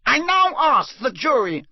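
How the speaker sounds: high firm shout; stern judge shouting. Acted. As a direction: shout the demand over the noise. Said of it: "shouted Mister Crouch".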